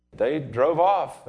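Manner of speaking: ordinary talk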